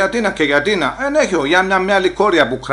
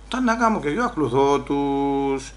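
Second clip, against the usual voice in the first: slow, softer resigned delivery; resigned compliance; 5 dB quieter, slower than usual. Retold or imitated. Immigrant going along with the police, slow and resigned. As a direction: drag it out, giving in.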